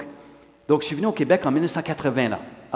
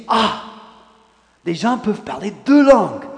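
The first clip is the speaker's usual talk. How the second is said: higher and louder, wide-eyed wonder; amazed child; 8 semitones higher, 10 dB louder than usual. Retold or imitated. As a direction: discover it like an eight-year-old, astonished. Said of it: "Et je dis".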